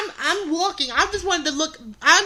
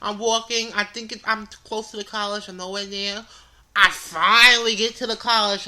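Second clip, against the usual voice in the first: normal talking voice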